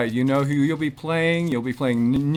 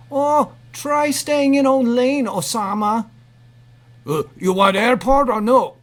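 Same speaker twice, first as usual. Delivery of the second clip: cab driver voice; 8 semitones higher, 6 dB louder than usual. Acted. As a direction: bark at the other car like a rude cabbie. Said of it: "I'll be the cab driver".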